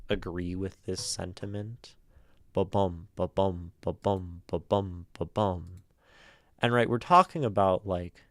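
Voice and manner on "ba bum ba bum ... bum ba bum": ba-bum as a steady heartbeat, weak ba then stressed bum; heartbeat rhythm. Shown as a demonstration. Streamer compares iambic meter to a heartbeat, steady beat. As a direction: pulse it evenly like a heart. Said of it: "as something of a heartbeat".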